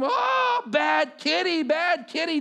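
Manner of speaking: put-on high, loud wail of a hurt child; mimicking the scratched boy screaming at the cat; imitating a child's scream. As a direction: cry out like a clawed toddler. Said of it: "the kid then starts to scream".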